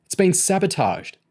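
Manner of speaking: high-pitched, mild yell; yelled back. A narrator's reading. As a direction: yell a reply across the garage. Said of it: "James yelled back".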